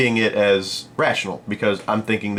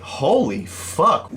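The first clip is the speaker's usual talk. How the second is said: slow, higher-pitched stunned swearing; 6 semitones higher, much slower than usual. Retold or imitated. Seller reacting to the angry caller, slowly stunned. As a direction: draw it out in mock disbelief.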